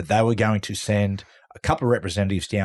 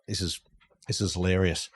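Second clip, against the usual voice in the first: ordinary delivery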